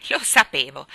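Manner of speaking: loud, low, triumphant I-knew-it; triumphant outburst. A narrator's reading. Neighbour gloats to the girl, loudly triumphant. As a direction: burst out, pleased to be right. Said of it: "sbottò la signora Linde trionfante".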